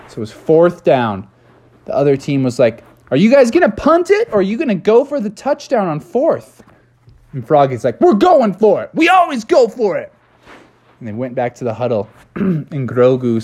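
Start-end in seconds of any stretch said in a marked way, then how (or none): 3.1-6.6 high-pitched taunting question; acted rival's challenge, pitched up; challenging rival team. Other team challenges Froggy's team. 8.0-10.1 high, fired-up declaration; cartoonish defiant rally; gung-ho reply. Froggy defiantly answers the rivals.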